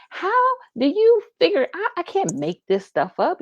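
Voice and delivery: high, loud indignant protest, easing off; upset retort. Retold or imitated. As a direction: retell your exasperated comeback to the doctor. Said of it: "I kinda got upset too".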